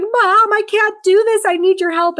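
high, loud, frantic pleading; mock panicked daughter. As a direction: whine like a scared grown kid begging mom. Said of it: "then she's freaking out".

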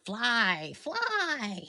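high, loud shrieking witch. Acted. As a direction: screech it like a wicked witch. Wicked Witch quote.

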